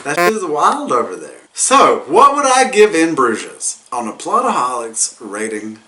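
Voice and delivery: normal talking voice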